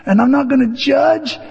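high, strained self-pep-talk; bracing to listen. Retold or imitated. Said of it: "inside I'm going".

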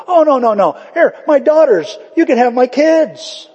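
high loud panicky offer; hammed-up panicky father; frantic Lot. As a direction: beg the mob in a rush.

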